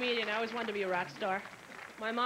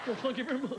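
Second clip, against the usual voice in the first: [name] impression. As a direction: sneer it like the King. [name] catchphrase.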